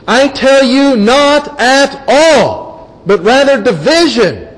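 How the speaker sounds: high, slow, stressing each word; emphatic Jesus quote. Acted. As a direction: proclaim it, drawing out "not at all". Quoting Jesus's answer with emphasis.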